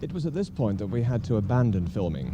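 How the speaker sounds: usual speaking voice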